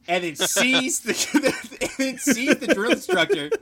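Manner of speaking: high, loud, cracking up; real laughter breaking into the words; laughing while telling. Real, not acted. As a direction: struggle to get it out through laughter. Teller cracks up at his story.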